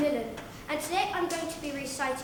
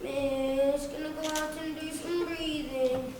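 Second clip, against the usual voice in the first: softer, drawn-out pupil's whine; whiny kid; 2 semitones lower, 4 dB quieter, slower than usual. Acted. Whiny kid asking miss to go out, whining plea. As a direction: plead whinily, stretching the words. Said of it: "The whiny ones".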